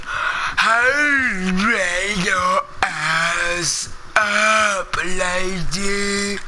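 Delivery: long drawn-out mock-slow command. Shown as a demonstration. Player spelling it out to the lady, exaggeratedly slow. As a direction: drag every word out to an absurd length. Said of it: "I gotta say it slow".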